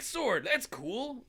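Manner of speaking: high, slow, questioning approval; mock impressed. Dude reacting to his power, mock impressed. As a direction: draw it out, lilting up at the end.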